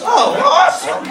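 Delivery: high, squeaky thrilled awe; gullible delight. Retold or imitated. Answering the inner voice, high and thrilled. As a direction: squeal like a believing kid. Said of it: "I'm like".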